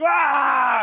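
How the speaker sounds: high loud scream as he falls; falling scream. Acted. Falling into the vat.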